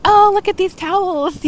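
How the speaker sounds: high, bright gushing over the towels; gushing customer. Retold or imitated. Couple in the store admiring towels, high gushing voice. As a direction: coo like a delighted shopper.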